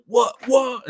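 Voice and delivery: high, loud mock-shocked outburst; shocked reaction. Roommates reacting to a movie twist, high shocked cries.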